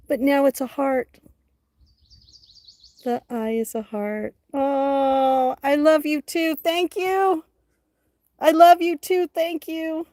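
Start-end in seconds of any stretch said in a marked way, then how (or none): 4.5-10.1 high, loud and gushing; excited. Real, not acted. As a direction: gush thanks to the chat. Thanking chat with excitement.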